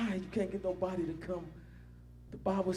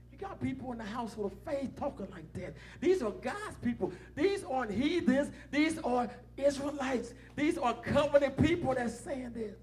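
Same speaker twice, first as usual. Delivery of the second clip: preaching at full force; 7 semitones higher than usual. Acted. Preacher scolding his church, in full preaching force. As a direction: hammer it home from the pulpit.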